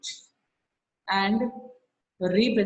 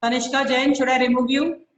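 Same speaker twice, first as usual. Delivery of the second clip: stern warning; 4 semitones higher, 5 dB louder than usual. Real, not acted. Teacher threatens student in online class.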